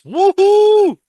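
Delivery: loud, very high woohoo; excited cheer for the big match; cheering whoop. Real, not acted. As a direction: whoop it out like a fan in the stands.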